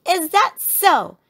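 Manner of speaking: slow, high, loud challenge; shouted child's retort; indignant shout. A narrator's reading. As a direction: fling it back loudly, drawn out. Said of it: "shouted Sister".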